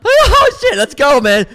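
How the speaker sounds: high, loud thrilled yelp; thrilled shout. Retold or imitated. Fan who just slipped through the gate, high loud thrill. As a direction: cheer yourself on after sneaking in.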